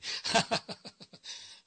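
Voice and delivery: high breathy giggle; real laugh. Real, not acted. Host laughing at forgetting the saying, a high breathy giggle.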